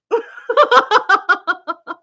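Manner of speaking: high rapid chuckling run; real laughter. Real, not acted. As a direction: laugh out in high little bursts. Amused by filled page.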